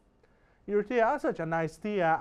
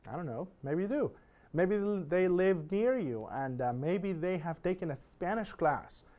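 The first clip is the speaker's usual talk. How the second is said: ordinary talk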